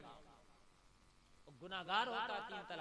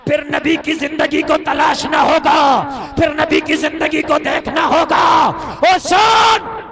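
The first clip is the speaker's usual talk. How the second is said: high shouted proclamation, ending in a higher cry; full-force preaching; 9 semitones higher, 27 dB louder than usual. Real, not acted. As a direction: drive it home at full volume. (पूरे ज़ोर से गरजती तक़रीर)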